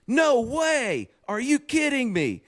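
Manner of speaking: amazed teacher. Retold or imitated. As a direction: act out the teacher's thrilled surprise.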